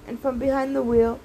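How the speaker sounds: plain reading voice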